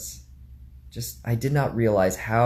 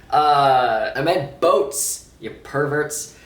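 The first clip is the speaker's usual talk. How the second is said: teasing; 5 semitones higher, 6 dB louder, much slower than usual. Real, not acted. Teasing correction to viewers.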